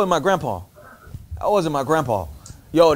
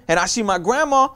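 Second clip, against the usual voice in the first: usual speaking voice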